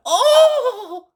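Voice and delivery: very high, loud cry of despair; cried out. A narrator's reading. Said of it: "she cried".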